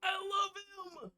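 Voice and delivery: slow, high, drawn-out fangirl shout; excited squeal. Real, not acted. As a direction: gush over him at the top of your voice. Fangirl outburst.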